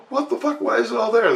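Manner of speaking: very high-pitched, incredulous outburst; retold alarm. Newbie questions the crew about bones, mock panic. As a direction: demand an answer in a high panic.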